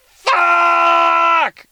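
long, drawn-out high scream; acted scream of frustration, held at full volume; mad parent. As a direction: stretch it into one loud, high howl. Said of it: "You're so mad at your kid".

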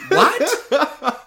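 high, laughing "what?"; laughing disbelief. Real, not acted. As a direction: laugh through the word in shock. Coach's absurd demand, disbelief.

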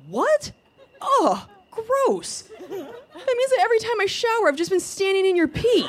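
high, disgusted whiny voice; grossed-out boyfriend. Retold or imitated. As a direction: squeal it in shock and disgust. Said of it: "instead he was like".

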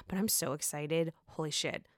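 hushed excitement. Real, not acted. Hushed thrill.

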